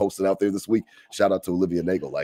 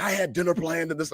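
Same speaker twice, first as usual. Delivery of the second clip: mock ex-wife complaint; 8 semitones higher, 4 dB quieter than usual. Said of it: "She's like".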